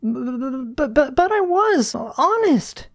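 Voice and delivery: very high, stammering plea; frightened schoolgirl protesting her honesty; trembling voice. A narrator's reading. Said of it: "said Susie through trembling voice".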